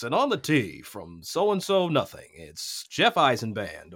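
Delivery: slow, put-on announcer voice, stretching each word; a mock golf broadcaster introducing the tee shot. Shown as a demonstration. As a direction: play the stiff TV golf announcer. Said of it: "Let me do the broadcast voice".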